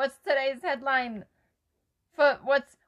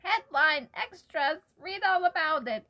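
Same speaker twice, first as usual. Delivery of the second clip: newsboy cry; 3 semitones higher than usual. Pretend. Friend about to hear their headline, playing newsboy. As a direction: hawk it like the day's paper. Mimics newspaper seller.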